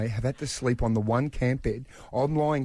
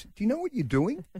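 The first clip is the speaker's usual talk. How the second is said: put-on high, doubtful young son; a kid asking, not sure dad knows; child voice; 10 semitones higher than usual. Son doubting his dad's tent skills, high child voice.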